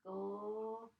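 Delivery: "Glass" "crack" "when" "it" "fell" with long gaps; dictating for first graders; word by word. Shown as a demonstration. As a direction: stretch each word out for spelling. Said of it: "there's that blend".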